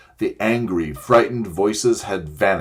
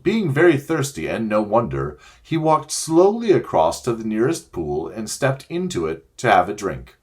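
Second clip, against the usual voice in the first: ordinary delivery, nothing marked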